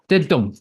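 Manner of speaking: "did dumb" said as an unstressed then stressed beat; iamb beat. Shown as a demonstration. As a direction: tap out "did dumb" as da-DUM. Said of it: "An unstressed followed by a stressed syllable".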